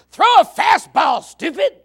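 high, loud yell from the stands, drawn out; mock yell at the pitcher. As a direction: bark it at the pitcher like a fan. Parent yelling at the pitcher.

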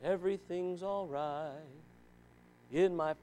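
a hushed, lulling mock calm; mock gentle Jesus. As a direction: parody a Jesus who never rocks the boat. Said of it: "just said".

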